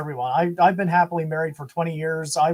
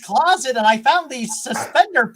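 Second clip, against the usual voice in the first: higher, louder campy flamboyant voice; 7 semitones higher, 6 dB louder than usual. Retold or imitated. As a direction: ham up a sparkly camp persona.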